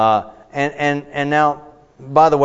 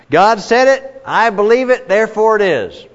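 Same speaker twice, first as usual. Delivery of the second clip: higher, louder sing-song slogan; naive believer's slogan; 7 semitones higher, 4 dB louder than usual. Retold or imitated. Teacher mocking a naive believer's slogan, higher and louder. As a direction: chant it like a simple creed. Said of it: "You just say".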